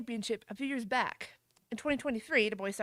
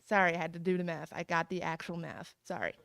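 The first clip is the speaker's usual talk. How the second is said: ordinary talk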